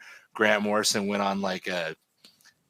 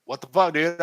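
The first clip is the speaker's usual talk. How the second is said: higher-pitched mock outrage; retold angry confrontation; 7 semitones higher, 4 dB louder than usual. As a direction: relive the indignation, pitch up. Retelling confronting artist angrily.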